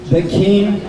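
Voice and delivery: plain reading voice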